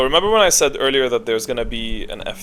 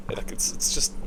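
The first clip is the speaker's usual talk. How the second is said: under breath; 7 dB quieter than usual. Real, not acted. Exasperated aside about Mirana.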